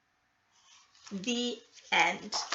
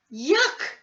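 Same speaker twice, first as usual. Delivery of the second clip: disgusted; 7 semitones higher, 6 dB louder than usual. A narrator's reading. Camila refusing beans.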